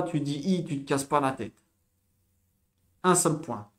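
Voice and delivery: ordinary talk, nothing marked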